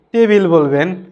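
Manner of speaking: ordinary delivery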